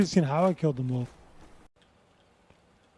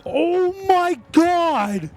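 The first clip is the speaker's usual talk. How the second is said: high, stretched-out shriek of panic; panicked scream; 10 semitones higher, 7 dB louder, much slower than usual. Real, not acted. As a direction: scream it, drawn out and terrified. Chased in horror game, screaming.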